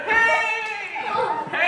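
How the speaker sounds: high, barked challenge; bad-cop shout. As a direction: burst in like a tough cop. Bad cop intimidation act begins.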